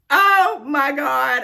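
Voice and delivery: loud, high, drawn-out astonishment; overwhelmed cry, stretched and high; real squeal. Real, not acted. On seeing the surprise gift, squealing. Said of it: "now that I've squealed".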